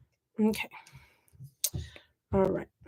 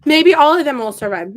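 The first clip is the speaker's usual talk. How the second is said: loud, higher cover-up after a whisper; mock-hopeful reassurance; 4 semitones higher, 8 dB louder than usual. Gardener reassuring her seedlings they may survive, loud mock-hopeful. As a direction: announce it brightly for the plants to hear.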